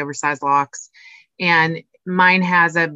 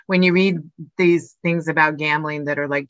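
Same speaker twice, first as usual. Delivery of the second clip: ordinary talk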